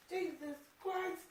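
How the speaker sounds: high, slow, exasperated exclamation. Real, not acted. Cook realizing what's missing, exasperated. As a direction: draw it out in disbelief. Realizes she forgot an ingredient.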